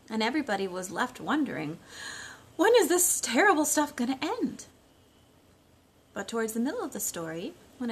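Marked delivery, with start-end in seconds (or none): 2.6-4.7 higher, louder fed-up wail; exasperated townsfolk. Acted. The cranky town wonders aloud, high and exasperated. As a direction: throw up your hands, cranky and tired of it.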